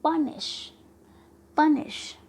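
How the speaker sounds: "Punish" with प high and strong; stress before suffix. Shown as a demonstration. Teacher showing stress before a suffix, प stressed in "punish". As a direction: punch प, let निश drop in "punish". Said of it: "उस पर trust देते हुए हम इसे कैसे कहेंगे".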